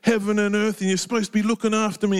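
high, whiny rant at God in one unbroken rush; grumbling, high-pitched babble; grumpy babbling prayer. Acted. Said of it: "He could have had a big grumpy with God".

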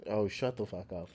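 normal talking voice

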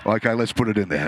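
lightly voiced God, very low; a hint of a deep-voiced God; God deciding. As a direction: drop low for God's casual verdict. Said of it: "vision of God saying to the angels".

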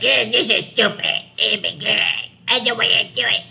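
high, put-on whiny voice; mock bitching. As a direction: exaggerate your younger self's griping. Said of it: "fucking bitching".

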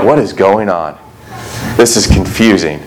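a touch of dopey confusion; dim puzzled disciple. Retold or imitated. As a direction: play the clueless follower scratching his head. Disciples puzzled at empty tomb.

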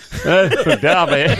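laughing. Real, not acted. Laughing at his own bad accent.

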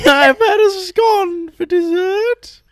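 high, loud, slow wail through laughter; laughing mock confession. Joking about having a scone, laughing mock confession. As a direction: confess it tearfully, cracking up.

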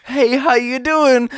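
high, loud, drawn-out imitation; mocking mom's greeting. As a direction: stretch out a fake-casual hello, hammed-up. Mocks mom's sudden text, laughing.

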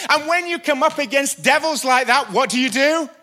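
loud, high preacher's call; fiery preaching. Acted. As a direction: drive the point home from the pulpit. Preaching at full force.

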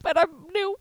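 high-pitched mock apology; apologetic new employee. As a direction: squeak out a hammed-up little excuse. Acting out meek reply to boss.

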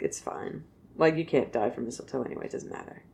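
dismissive whisper. Retold or imitated. Frigg shrugging off the mistletoe to herself, whispered. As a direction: toss it off under your breath.

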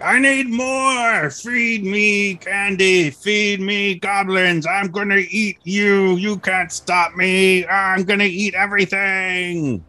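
high, slow, drawn-out monster voice; ravenous monster mouth. Acted. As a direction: wail each demand like an insatiable creature. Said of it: "And it's like".

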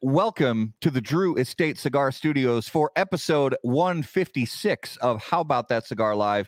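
high announcer opening on "Ladies"; show host intro. Acted. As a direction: welcome everyone like a showman. Host opens the live show.

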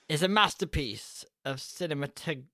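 normal talking voice

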